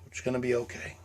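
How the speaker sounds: very soft, low comfort; tender whispered reassurance; self-soothing. Pretend. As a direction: murmur it like a hug. Soothing himself while hugging.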